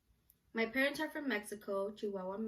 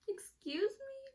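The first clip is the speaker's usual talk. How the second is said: very high, drawn-out mock swoon; swooning reaction; slower than usual. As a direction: squeak it slowly, as if smitten.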